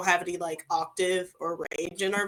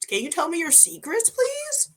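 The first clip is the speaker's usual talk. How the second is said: mock pleading; 10 semitones higher than usual. Begging self-aware people for their secrets, high mock plea. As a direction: voice climbing very high to a squeak.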